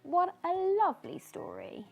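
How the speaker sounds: usual speaking voice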